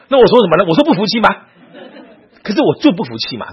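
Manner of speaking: loud, high-pitched grievance; acted-out exasperated defiance; indignant protest. Voicing his indignant inner protest.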